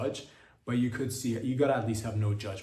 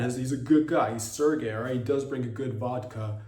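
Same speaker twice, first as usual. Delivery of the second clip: ordinary talk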